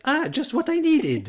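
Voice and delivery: very high, drawn-out cartoon catchphrase; hammed-up falsetto relief; Mario. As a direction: squeak it out like a powered-up game hero. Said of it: "And then genuinely he says".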